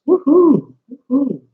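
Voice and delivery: high gleeful woohoo, tapering; excited cheer. Real, not acted. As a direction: cheer like the show is finally on. Cheering at start of live.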